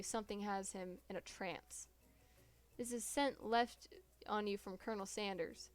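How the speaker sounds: normal talking voice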